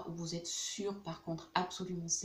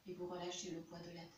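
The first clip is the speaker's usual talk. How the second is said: soft, unhurried meditation voice with long pauses; guided meditation; 8 dB quieter than usual. Real, not acted. Yoga teacher guiding students into Yoga Nidra, soft guided meditation.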